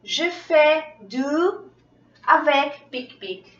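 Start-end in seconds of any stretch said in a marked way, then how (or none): 0.0-1.7 je fais du, slow and high, fais rising; teacher stretches out je fais du slowly; slow model sentence. Shown as a demonstration. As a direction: model the phrase slowly for the class. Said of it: "Entonces digo".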